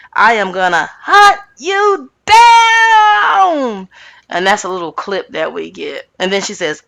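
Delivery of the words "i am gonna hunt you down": high, drawn-out mock scream; imitated yell. Retelling Rinna's threat over husband and kids, mock screaming. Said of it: "She yelled and screamed".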